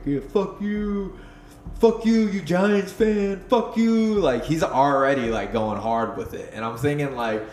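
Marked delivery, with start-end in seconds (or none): 0.0-4.1 high, drawn-out imitation of a yelling heckler; mock heckler yell. Imitating a guy heckling before Louis is on, high mock yell. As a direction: heckle like an obnoxious fan, stretched and high-pitched. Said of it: "he's just yelling out".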